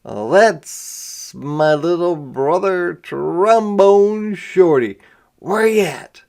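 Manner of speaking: slow proud brother's shout-out; brother James announcing. Retold or imitated. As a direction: show off the little brother to the crowd. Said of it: "he'd probably say".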